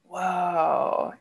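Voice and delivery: ordinary talk, nothing marked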